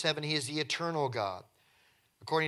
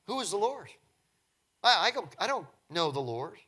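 "Who" pitched higher, mocking and dismissive; Pharaoh's scornful attitude; 4 semitones higher than usual. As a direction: throw the question back with scorn. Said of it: "This is his attitude".

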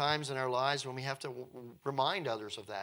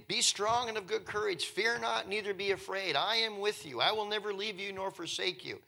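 higher, urgent run of reassurance; rousing Scripture quote; 7 semitones higher than usual. Acted. God encouraging his people in Scripture, rousing and high-pitched. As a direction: recite God's promise with lifted, driving voice.

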